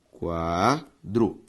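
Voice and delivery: "quadro" in two drawn-out parts, qua... dro; syllable by syllable. Shown as a demonstration. Teacher separating syllables for the class, syllable by syllable. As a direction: say "quadro" slowly, pausing between qua and dro. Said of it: "Ao separar quadro".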